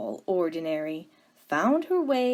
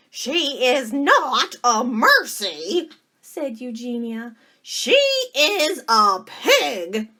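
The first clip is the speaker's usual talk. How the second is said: slow, loud, higher, emphatic objection; indignant Eugenia; 6 semitones higher, 8 dB louder, slower than usual. Acted. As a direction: lay down the law word by word.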